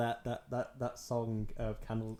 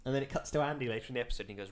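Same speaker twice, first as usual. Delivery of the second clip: normal talking voice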